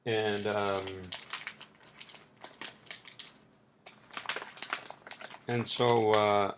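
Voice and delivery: talking while eating chips; words through a mouthful of chips; crunching chips. Real, not acted. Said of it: "I'm sorry, I'm eating chips".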